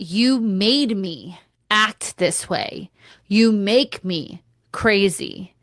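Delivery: slow, drawn-out accusing lines; slowed, lightly acted accusation; slow mock blame. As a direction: lay the blame on slowly. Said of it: "there's this blame".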